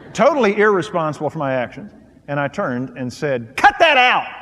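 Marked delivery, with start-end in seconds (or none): 3.6-4.3 louder, higher snap, retelling the moment; harsh retort. Retold or imitated. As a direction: bark it back like an irritated husband. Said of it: "I spoke harshly to my wife".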